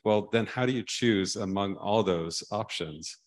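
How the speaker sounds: usual speaking voice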